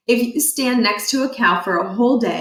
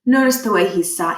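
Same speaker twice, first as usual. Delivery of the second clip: plain reading voice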